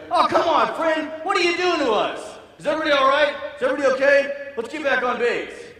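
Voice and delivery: high, loud shouting to the squad; acted officer yelling after running up; shouting lieutenant. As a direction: call out urgently across the trucks.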